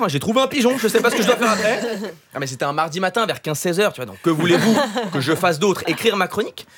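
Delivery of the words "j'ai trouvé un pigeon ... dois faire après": high-pitched mock employee confiding in the boss; hammed-up high helpless caller; flustered phone pollster. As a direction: play a baffled caller, voice pitched up. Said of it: "elle a dû aller chercher sa supérieure".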